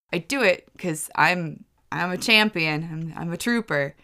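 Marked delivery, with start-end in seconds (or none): none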